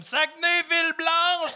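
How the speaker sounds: high proud proclamation; Jean Tremblay. Retold or imitated. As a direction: shout the slogan like a proud mayor. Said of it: "Il est comme".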